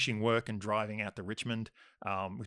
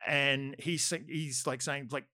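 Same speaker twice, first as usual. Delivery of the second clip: ordinary delivery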